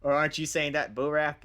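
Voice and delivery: high, loud bird voice; eagle underling. Acted. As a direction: pipe up loudly, questioning the boss. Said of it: "one of the eagles nearby goes".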